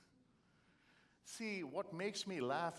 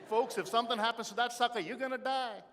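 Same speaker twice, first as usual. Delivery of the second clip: blunt punchline; 8 semitones higher, 6 dB louder than usual. Real, not acted. Punchline to the audience.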